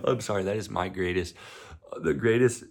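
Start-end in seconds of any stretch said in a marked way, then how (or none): 2.0-2.6 real laughter breaking through the words; telling a favourite memory while cracking up; giggling through the story; laughing. Real, not acted. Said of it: "I can't even think about it without laughing".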